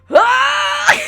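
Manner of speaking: loud, high replayed scream. Driver on a flooded reservoir bridge, high loud scream. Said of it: "I was just screaming".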